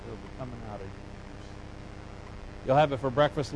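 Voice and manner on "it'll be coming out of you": stern God. Retold or imitated. God warning the complaining people, low and hushed. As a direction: lower and much softer, ominous. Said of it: "God says".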